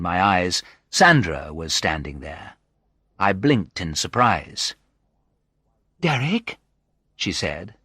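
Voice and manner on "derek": high, questioning woman's voice; surprised. A narrator's reading. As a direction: say the name in rising surprise. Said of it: "her eyes widening in surprise".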